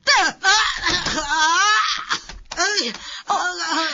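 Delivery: acted cries in a scuffle; pained cries. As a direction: cry out while your hair is pulled. Hair pulling fight.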